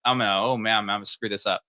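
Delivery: usual speaking voice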